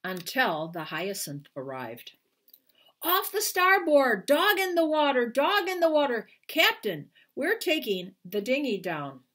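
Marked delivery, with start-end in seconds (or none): 3.0-6.3 high urgent shout across the water. Acted. Crewman alerting the ship, urgent shout. Crewman spotting dog overboard. 6.5-9.2 urgent call. Acted. Crewman calling to captain.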